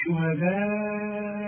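on شهداءكم, hold the alif, slow; long madd. Shown as a demonstration. Teacher demonstrates the four-count madd. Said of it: "لازم أمد المد ده".

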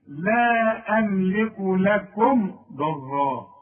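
لا أملك لكم ضرا recited slowly with the meem of لكم clearly closed; slow tajweed demo, lips shut on meem; Quran recitation demo. Shown as a demonstration. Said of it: "شوف".

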